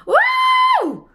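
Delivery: a very high, loud howl; howling wouh. Shown as a demonstration. Guide howling at the full moon, wolf howl. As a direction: let out a wolf's howl. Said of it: "pour pouvoir crier".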